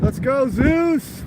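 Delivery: high, slow cheer; excited cheer. Real, not acted. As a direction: cheer on the storm. Cheering on the lightning.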